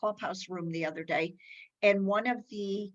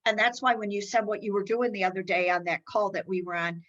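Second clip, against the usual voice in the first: ordinary talk, nothing marked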